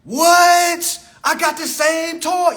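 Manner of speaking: put-on high, squealing girlfriend voice; hammed-up excited homegirl, high and laughing; homegirl gushing. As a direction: squeal it like you found your twin. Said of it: "your homegirl walking to a homegirl".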